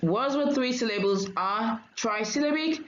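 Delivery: ordinary delivery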